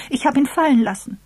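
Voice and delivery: put-on high, loud girlish alarm; upset, high-pitched outcry; dismayed. A narrator's reading. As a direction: blurt it out in consternation. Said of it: "Sie schrie bestürzt auf".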